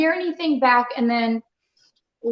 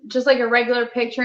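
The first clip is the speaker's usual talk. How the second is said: ordinary talk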